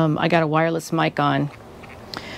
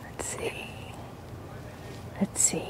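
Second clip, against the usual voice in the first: whispering; 18 dB quieter than usual. Real, not acted. Fiddling with camera.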